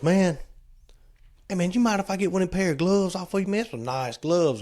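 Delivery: high, fast wheedling drifter voice; wheedling hitchhiker. Retold or imitated. Hitchhiker asking Trey for gloves, high wheedle. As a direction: sweet-talk for a favor.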